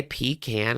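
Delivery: "pecan" said PEE-can, long ee first; PEE-can pronunciation. Shown as a demonstration. Allowing viewers their version, "pecan" as PEE-can. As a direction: say "pecan" as PEE-can, rhyming the end with "man". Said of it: "You could say pecan".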